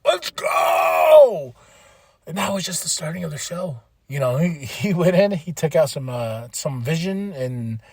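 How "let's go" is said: excited cheering. Real, not acted. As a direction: whoop it like in the arena. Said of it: "I was like".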